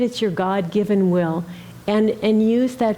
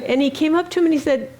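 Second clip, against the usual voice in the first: usual speaking voice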